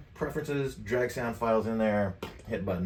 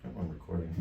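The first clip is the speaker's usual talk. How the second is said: normal talking voice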